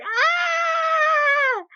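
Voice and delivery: high shrieking scream of joy; fan scream. Retold or imitated. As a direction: let out an ecstatic scream. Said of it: "I was like".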